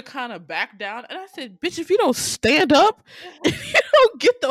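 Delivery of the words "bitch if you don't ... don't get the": high, loud urging with laughter breaking in; exasperated mock scolding. Viewer urging Rita not to back down, exasperated. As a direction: scold loudly, pitch climbing. Acting her reaction to Rita backing down.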